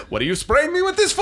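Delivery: put-on high, indignant kid voice; imitating angry son. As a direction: mimic the outraged boy.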